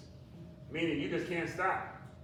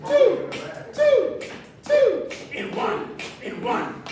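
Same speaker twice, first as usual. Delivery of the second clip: high, loud pumped-up rep count; excited countdown; 10 semitones higher, 7 dB louder than usual. Acted. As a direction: whoop between counts to fire them up. Counting down reps, whooping.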